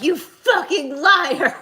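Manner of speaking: loud, high, drawn-out yell; mock furious scream. Daughter imagines calling out mom, comic screaming. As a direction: scream the accusation slowly, breaking into a laugh.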